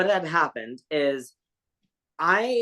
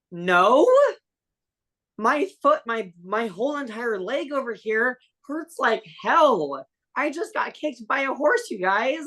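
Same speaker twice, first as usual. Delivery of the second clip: high, whiny mock-wail of pain; exaggerated whining; 7 semitones higher than usual. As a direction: overplay the hurt like a wailing kid. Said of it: "I know I didn't react like that, but I was probably, like, crying".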